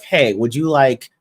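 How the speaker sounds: a vendor's slow sales pitch; slow tempting offer. Retold or imitated. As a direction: draw the words out slowly. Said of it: "they're like".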